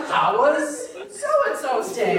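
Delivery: slow, laughing, drawn-out reading; dragged out. Real, not acted. Reading the husband's mangled text aloud, slow and laughing. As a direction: stretch each word in disbelief while laughing.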